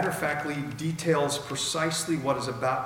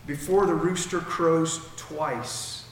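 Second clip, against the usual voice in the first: Jesus speaking firmly; 2 semitones higher, slower than usual. Acted. Jesus to Peter, slow and solemn. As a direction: lay down each word slowly as Jesus. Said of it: "He looks at him and says".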